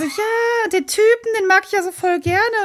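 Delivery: put-on high, swooning girlish gush; gushing Cassandra. Retelling the game, mimicking Cassandra's crush in a high voice. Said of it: "Und sie so".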